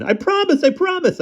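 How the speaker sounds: very high frantic begging; terrified pleading. Acted. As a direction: beg to be let go. Begging attacker for mercy.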